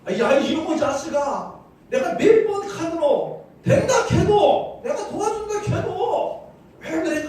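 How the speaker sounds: high, loud scolding; exasperated high-pitched telling-off; angry outburst. Acted. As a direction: explode like someone out of patience. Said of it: "나도 화를 낼 거예요".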